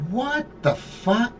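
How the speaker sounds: high, slow, drawn-out what the fuck; stunned disbelief. Retold or imitated. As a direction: let the shock stretch out slowly. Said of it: "And I'm going".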